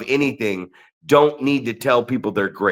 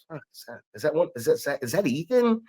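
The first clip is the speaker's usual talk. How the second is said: retold puzzlement; 3 semitones higher, 7 dB quieter, faster than usual. Acts out puzzled head-scratching.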